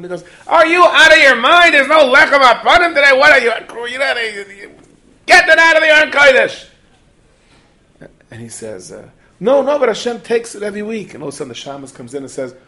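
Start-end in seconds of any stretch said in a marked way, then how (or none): 0.5-6.7 very loud, high-pitched outraged shouting; furious yelling. Acted. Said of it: "why do you have to yell at him". 9.4-11.1 loud, high-pitched pleading objection; earnest protest. Acted. Scolded man protests back.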